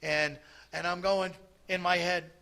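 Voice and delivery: ordinary talk, nothing marked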